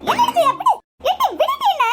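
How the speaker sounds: high, loud indignant cry; protesting shout. Acted. Mother-in-law yells at daughter-in-law dragging her, outraged. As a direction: fight being hauled out.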